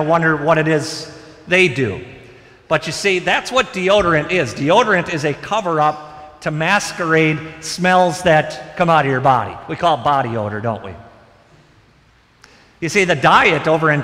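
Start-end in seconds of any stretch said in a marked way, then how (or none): none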